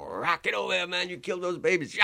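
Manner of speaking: high-pitched, hammed-up raving; imitated wild accusation. Sly chasing the storyteller down the hallway, imitated wild accusation. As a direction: imitate a man ranting as he gives chase.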